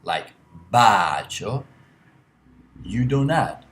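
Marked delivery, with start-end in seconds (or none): 0.7-1.6 "bacio" with a loud, long "ba" and a light "cio"; stress off the I. Shown as a demonstration. Teacher repeating the rule with bacio, first syllable stressed. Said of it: "is not stressed in the I".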